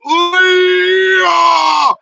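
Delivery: loud high hammed-up ooh yeah; loud high mock ooh of relief; mock animal relief. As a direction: ham up a blissful groan of relief.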